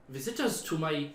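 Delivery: lightly voiced chatty hermit; a hint of a cheery cave dweller.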